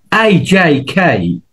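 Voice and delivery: আই জে খাই slow and sing-song, each letter stretched; playful letter drill. Shown as a demonstration. Teacher drills letters A J K, slow and playful. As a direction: stretch out আই জে খাই like a fun chant. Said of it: "আমি মজা করে শিখাই এভাবে".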